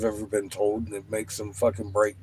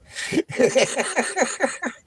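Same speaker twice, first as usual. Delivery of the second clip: real laugh, pitched way up; high giggling laugh. Real, not acted. Storyteller laughing at his own tale of blowing Wayne's mind.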